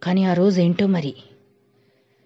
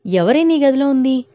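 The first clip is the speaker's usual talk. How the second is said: startled question; 8 semitones higher, 4 dB louder than usual. A narrator's reading. Mother startled by daughter's cry.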